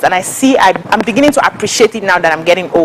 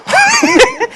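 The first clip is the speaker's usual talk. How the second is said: high burst of laughter. Real, not acted. Laughs at own confession.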